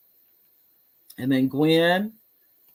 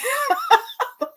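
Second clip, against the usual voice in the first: high delighted cackle; high burst of laughter. Real, not acted. Host cracks up at her cousin's comment, high laugh.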